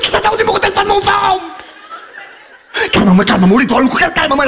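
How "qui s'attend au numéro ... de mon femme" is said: high fast outburst. Real, not acted. Outburst on phone about wife.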